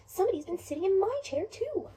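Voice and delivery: high little cub voice; Baby Bear. Acted. Little bear seeing his chair was used, high and upset. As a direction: pipe it up like an upset small child. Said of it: "Baby Bear comes over and says".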